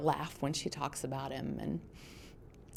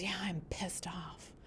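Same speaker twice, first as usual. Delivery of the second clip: hushed admission; 3 semitones higher, 11 dB quieter, slower than usual. Retold or imitated. Daughter admits anger, retold.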